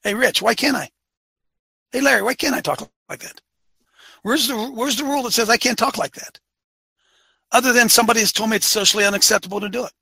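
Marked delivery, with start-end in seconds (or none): 0.0-6.4 higher-pitched, incredulous questioning; indignant challenge. Acted. Coach challenging listeners to show him the rule, indignant. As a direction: push back with raised, protesting pitch.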